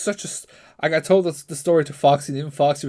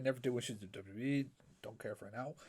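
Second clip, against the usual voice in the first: ordinary talk, nothing marked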